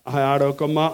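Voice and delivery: soft, tender, near-whispered comfort to a crying woman; a preacher softly soothing a weeping listener, tender hush. Shown as a demonstration. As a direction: hush her tears gently, barely above a breath. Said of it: "అని ఇట్లా అన్నారనుకోండి".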